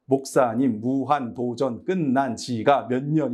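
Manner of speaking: slow, measured, each syllable of "무한도전 끝난 지가" spaced out. Retold or imitated. Kid handing the pastor information, "무한도전 끝난 지가" in slow separate syllables. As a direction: spell out "무한도전 끝난 지가" slowly, one syllable at a time. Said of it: "여러분들이 듣지 못한 수많은 음절들".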